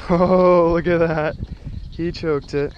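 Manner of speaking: high, very loud cry of amazement; real thrill at the catch; loud excited shout. Real, not acted. Angler shows his personal best, loud excited shout. Seeing the fish choked the lure.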